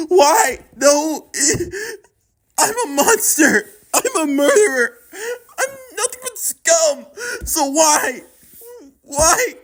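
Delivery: high, breaking, tearful self-blame; sobbing despair. Acted. Revi over her dead brother, sobbing. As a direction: weep and rage at fate.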